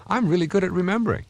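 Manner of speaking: bright, lifted take on the new label; upbeat new self-label. Shown as a demonstration. Showing a listener the upgraded belief, lifted and upbeat. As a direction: say it like you finally believe it.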